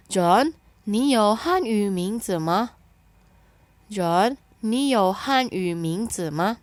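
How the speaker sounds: very slow, clear Mandarin, syllables spaced; slow model reading. Shown as a demonstration. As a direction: model it for learners to repeat. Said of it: "Kita baca ya".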